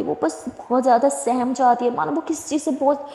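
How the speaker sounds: ordinary delivery, nothing marked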